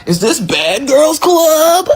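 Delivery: slow, high mocking drawl of Anaya; high, slow, snide mock quote. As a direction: stretch it out snidely, pitched well up. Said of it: "Anaya's stupid ass gonna say".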